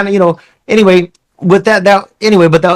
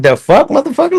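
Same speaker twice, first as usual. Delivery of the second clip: mock indignant disbelief; 10 semitones higher than usual. Reacting to a stranger wanting a hug, mock indignant and high. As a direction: play at being taken aback.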